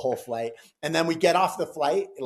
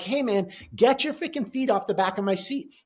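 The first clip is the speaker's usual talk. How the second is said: high, irritated retelling of a demand; raised-voice confrontation; 7 semitones higher than usual. Retold or imitated. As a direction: confront the passenger in a raised pitch. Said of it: "my voice is very raised".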